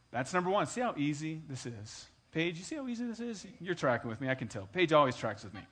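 ordinary talk, nothing marked

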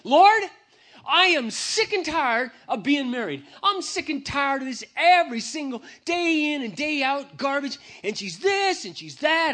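high, loud angry complaint; pouring out frustration to God; venting out loud. Retold or imitated. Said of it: "first time I ever did it out loud".

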